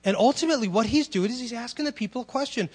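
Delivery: ordinary talk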